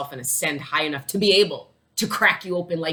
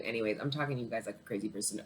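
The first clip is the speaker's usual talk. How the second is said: ordinary delivery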